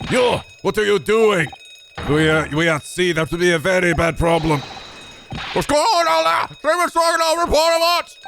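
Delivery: a crewman demanding what is going on; alarmed crewman. Acted. Voices the ship crewman.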